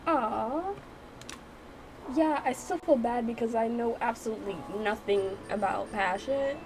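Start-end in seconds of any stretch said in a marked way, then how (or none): none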